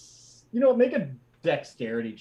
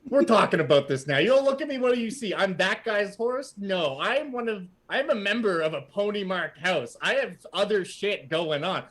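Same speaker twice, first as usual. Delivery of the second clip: indignant outburst; 3 semitones higher than usual. Acted. Horse rebuts the party, offended high rant. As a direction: defend his pride, offended.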